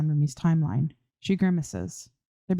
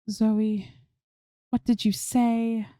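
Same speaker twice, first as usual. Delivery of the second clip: higher, slow, worried asking; concerned question; 5 semitones higher, much slower than usual. A narrator's reading. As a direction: ask with concern, not blame. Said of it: "said more with concern".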